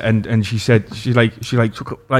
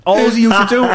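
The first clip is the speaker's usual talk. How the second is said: laughing while telling; 9 semitones higher than usual. Real, not acted. Telling a story he can't stop laughing at.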